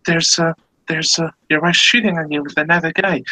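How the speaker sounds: high, hesitant mock alarm; acted shock, pitched high; shocked hunter. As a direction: stammer out bad news to a friend. Joke hunter breaks bad news.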